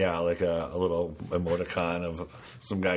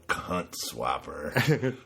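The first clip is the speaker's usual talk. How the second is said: drawn-out sleazy delivery; 3 semitones lower, much slower than usual. Shown as a demonstration. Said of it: "Make it all one word".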